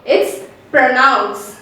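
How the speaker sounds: "pronounce" said slowly with unrounded pruh; drawn-out teacher model of "pronounce"; "pronounce" as pruh-nounce, slow; slow model word. Shown as a demonstration.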